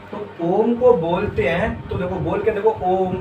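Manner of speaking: ओम held long and slow; long vowel demo. Shown as a demonstration. Teacher asking students to say ओम, drawn out slowly. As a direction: stretch the ओ of ओम. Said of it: "इससे काफ़ी ज़्यादा समय लग रहा है".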